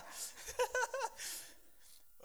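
real laugh at himself; breaking into an amused laugh. Real, not acted. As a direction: burst out laughing at your own slip. Laughs at own accidental accent.

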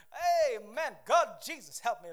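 loud half-joking plea; loud pretend distress; mock cry for help. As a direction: call out to God in comic panic. Crying out against temptation.

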